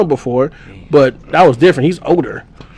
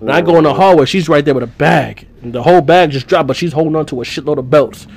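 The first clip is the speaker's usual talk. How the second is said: usual speaking voice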